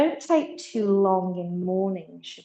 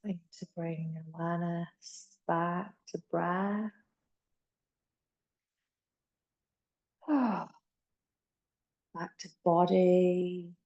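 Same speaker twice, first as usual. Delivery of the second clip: guided meditation. Acted. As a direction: ease them gently into stillness. Leads the rest as a meditation.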